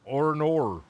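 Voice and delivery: drawn-out Australian "oh no"; Australian accent. Shown as a demonstration. Bandmate told to say "oh no" in Australian, Australian accent. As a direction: say "oh no" with a broad Australian r-sound. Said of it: "say "oh no" in Australian".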